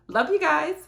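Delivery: ordinary talk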